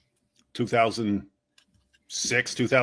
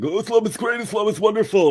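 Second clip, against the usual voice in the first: higher, sing-song mock praise; mock gushing; 7 semitones higher than usual. Mocking the West's praise with a higher gushing voice. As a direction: gush it naively.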